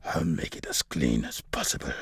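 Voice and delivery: deep, creepy villain murmur; menacing. A narrator's reading. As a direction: promise it in a very low, sinister voice. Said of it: "Antonio chuckled menacingly".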